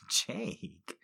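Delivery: soft breathy sigh of a name. Acted. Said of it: "she breathed".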